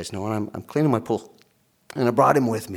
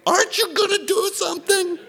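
very high, squealed mock plea; hammed-up high-pitched desperate cry; panicked child; 4 dB louder than usual. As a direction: demand help like a kid going under. Panicked son pleading with dad.

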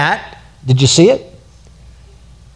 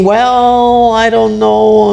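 doubting believer; 6 semitones higher, much slower than usual. Retold or imitated. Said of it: "you're thinking".

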